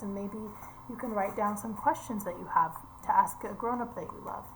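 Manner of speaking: ordinary delivery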